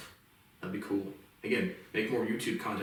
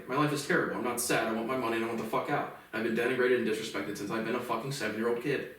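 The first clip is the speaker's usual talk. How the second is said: ordinary talk, nothing marked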